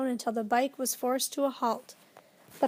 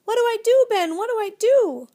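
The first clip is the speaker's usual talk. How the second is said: much higher and louder frantic shout; panicked shouting; 10 semitones higher, 9 dB louder than usual. A narrator's reading. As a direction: scream for help in panic. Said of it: "Tom was shouting".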